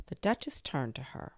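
ordinary delivery

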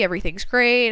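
ordinary delivery

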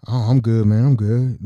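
low, heavy tough guy greeting; deep big guy voice. Retold or imitated. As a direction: voice him low and gruff.

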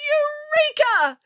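very high, long-drawn exclamation; triumphant cry. A narrator's reading. Scientist has solved the king's problem, triumphant cry. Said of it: "cried Archimedes".

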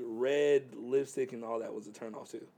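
ordinary talk, nothing marked

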